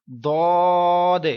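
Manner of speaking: دود held long in a full heavy voice; teacher recites heavy دود slowly; full heavy letter. Shown as a demonstration. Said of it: "پورا آواز میں پڑھیں گے".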